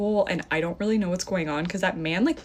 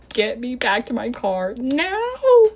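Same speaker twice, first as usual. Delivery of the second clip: high, loud order building to a long final word; retold angry scream; 6 semitones higher, 5 dB louder, slower than usual. Woman screaming at date after the crash, acted furious demand. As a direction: act out screaming at him, stretching the end. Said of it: "I just start screaming at him".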